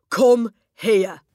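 barked, high and loud; angry shout. Acted. Headmistress summons the girl, furious shout. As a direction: hurl the word at her like a command.

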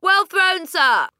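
very high, loud call from afar; cheering shout. Acted. A pupil cheers the throw from across the playground. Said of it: "someone shouted from across the playground".

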